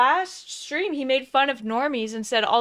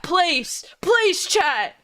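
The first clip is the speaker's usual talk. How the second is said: higher, hammed-up begging; mock pleading; 6 semitones higher, 4 dB louder, much slower than usual. Friend playing streamer begging his chat, mock pleading. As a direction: plead in exaggerated desperation.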